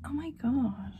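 soft unvoiced whisper; whispered disbelief. Real, not acted. Stunned by the scene, whispering to herself. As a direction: breathe it out in disbelief.

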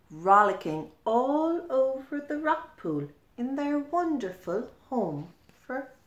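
plain reading voice

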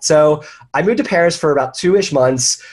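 ordinary talk, nothing marked

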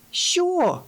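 very high-pitched yelled question; bawling. A narrator's reading. Brioche unable to hear the fox, bawling back. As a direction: yell it high over the din. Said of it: "загорлала Бріош".

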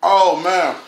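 eating between words; talking while chewing food. Real, not acted. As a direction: mouth partly full. Seen 2: Holds macaroni forkful while talking, takes bite at 2.2s.

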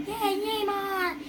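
slow, high-pitched, drawn-out rooting; high little cheer. Pretend.

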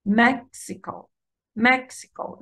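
مكسيكو with light trailing syllables; first-syllable stress. Shown as a demonstration. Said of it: "الاسترس على المقطع الأول".

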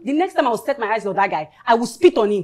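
loud, high outrage; angry vow. Acted. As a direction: build to a loud threat. Vowing to spit on ex.

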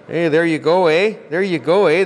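mock Canadian eh. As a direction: play the proud Canadian, rising on each "eh". Joking about Canadian money.

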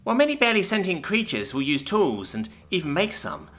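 small mouse offering its view modestly; squeaky mouse voice. Acted. Mouse-like sec speaks.

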